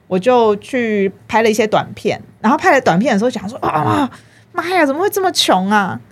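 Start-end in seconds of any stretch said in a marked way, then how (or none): 3.7-6.0 high-pitched, exasperated wail; mock dismay. Guest recalling shooting short films broke, mock dismay. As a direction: lament it in comic despair.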